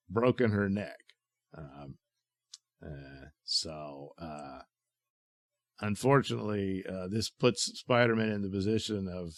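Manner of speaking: ordinary talk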